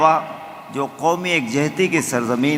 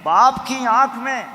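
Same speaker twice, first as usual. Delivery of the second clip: raised high, emphatic recitation; high declaimed verse; 10 semitones higher than usual. Acted. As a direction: lift the line high for the audience. Line repeated raised high.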